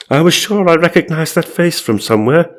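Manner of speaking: high, hammed-up storyteller; mock-excited reading; dramatic ghost narration. A narrator's reading. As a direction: play up the dawning recognition, pitched high.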